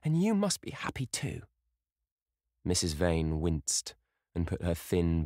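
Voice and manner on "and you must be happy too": put-on young girl urging. A narrator's reading. Sybil pleading to her mother.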